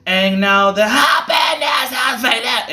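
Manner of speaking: mocking high, drawn-out put-on voice; exaggerated, strange high delivery; weird singer imitation. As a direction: slowly wail it in a weird high voice. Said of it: "do this weird thing like".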